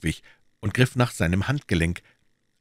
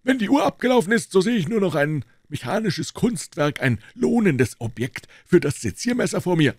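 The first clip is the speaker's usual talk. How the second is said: a lightly voiced doctor, a little higher; a hint of a composed doctor; the doctor explaining why he came in, lightly voiced; 8 semitones higher than usual. A narrator's reading. Doctor speaking in the story.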